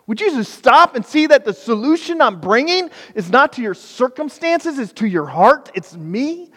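God leaning in, begging you to stop; God's voice. Acted. God pleads with his people to stop, high and urgent.

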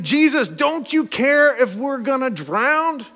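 high, slow, drawn-out appeal; mock pleading disciples. Disciples to Jesus in the storm, acted pleading.

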